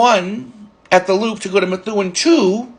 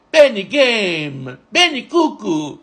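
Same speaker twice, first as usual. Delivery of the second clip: imitated Turkish accent, drawn out; 2 semitones higher, much slower than usual. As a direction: stretch the words in a mock Turkish accent. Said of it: "in his accent".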